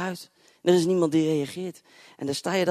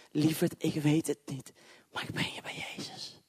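put-on soft whisper to a child; tender whisper; 5 dB quieter than usual. Preacher comforting a little girl, softly whispered.

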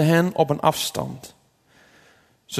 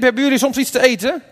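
high, quick call; 7 semitones higher, 4 dB louder, faster than usual. A narrator's reading. Jesus calls to the disciples from the shore, calling out. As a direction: call across the water to them. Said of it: "Hij riep".

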